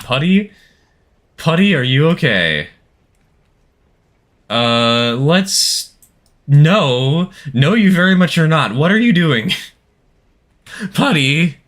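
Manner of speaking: shocked concern for the engine; alarmed. Real, not acted. Alarmed at Putty the train.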